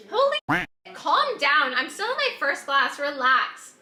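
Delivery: loud, laughing protest; loud teasing. Real, not acted. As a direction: call out loudly in jest. Teasing partner pouring wine.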